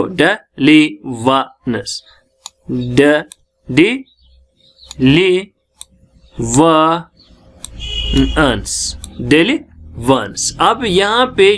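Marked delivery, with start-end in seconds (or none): 0.0-2.0 daily वंस broken slowly into syllables; slow teacher's breakdown of daily वंस. Shown as a demonstration. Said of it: "देखो". 2.7-9.0 daily वंस stretched out with long gaps between syllables; drawn-out teaching demo of daily वंस; very slow syllables. Shown as a demonstration. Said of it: "देखो".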